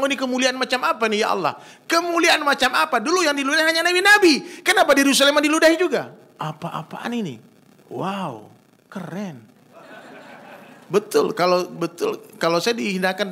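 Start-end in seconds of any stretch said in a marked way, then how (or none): 0.0-6.1 high, fast outcry building louder; impassioned exclamation. Retold or imitated. Retold exclamation to God, building. 6.3-9.4 slow, quiet marvelling; hushed wonder. Retold or imitated. Hushed wonder after the outburst.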